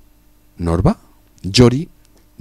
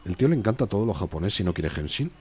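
ordinary talk, nothing marked

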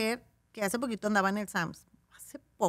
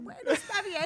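tearful agreement; 11 semitones higher than usual. Retold or imitated. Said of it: "llorando, y yo".

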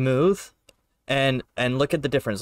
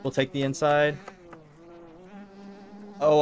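ordinary delivery

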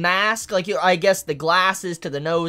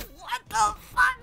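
higher, slow, drawn-out disbelief; stunned disbelief; 14 semitones higher, 6 dB quieter, slower than usual. Real, not acted. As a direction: gasp it out, baffled and amused. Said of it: "And I'm like".

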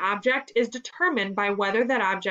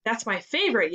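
ordinary delivery

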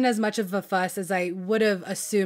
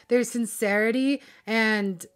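ordinary talk, nothing marked

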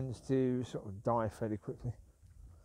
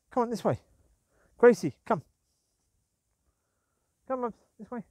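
loud call; calling the dog; 7 semitones higher, 6 dB louder than usual. Real, not acted. Owner calling Gracie, loud call. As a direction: call her name out.

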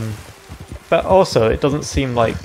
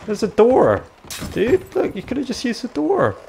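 much higher, exasperated laughing shout; incredulous outcry; 11 semitones higher than usual. Real, not acted. Player yelling at Sven who ignored the door, incredulous. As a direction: cry out in disbelief at your friend. Friend smashed through instead of door.

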